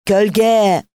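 high, loud hawker's call; one long, ringing peddler's shout; street seller's cry. A narrator's reading. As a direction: call out your wares to the whole village. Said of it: "O satıcı ağzını bırakmadan".

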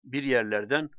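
plain reading voice

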